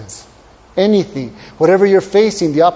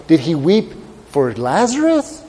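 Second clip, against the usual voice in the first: ordinary talk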